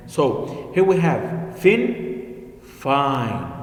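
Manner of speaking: fin short ih, fine long eye; contrast fin and fine. Shown as a demonstration. Teacher contrasting word pairs, slow. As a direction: set fin against fine slowly. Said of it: "notice the difference".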